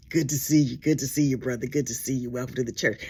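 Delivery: low, slick greeting voice; smooth deacon. Retold or imitated. Deacon greeting the couple, low and smooth. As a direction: drop deep and smooth like a charmer.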